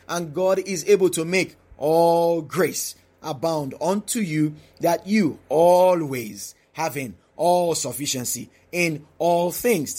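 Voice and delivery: slow verse reading, "all" drawn out long; stress on ALL. Shown as a demonstration. Preacher taking the verse again, stress on ALL. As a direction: stretch every "all" and "always", pausing after each phrase. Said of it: "the emphasis on the word all".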